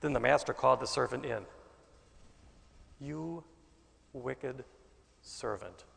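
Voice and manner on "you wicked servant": stern rebuke. A narrator's reading. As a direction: let each word drop quietly and gravely. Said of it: "he said".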